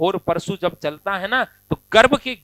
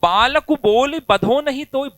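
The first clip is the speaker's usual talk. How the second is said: angry Parashurama; 5 semitones higher, 6 dB louder than usual. Acted. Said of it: "Parshuram जी को गुस्सा आ गया".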